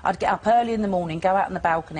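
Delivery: ordinary talk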